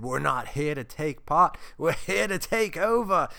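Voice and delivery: loud, badly faked Irish accent; hammed-up Irish accent, punched out loud. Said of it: "Sorry, that was a bad accent".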